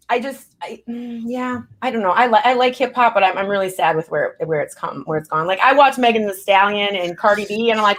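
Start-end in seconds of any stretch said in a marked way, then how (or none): none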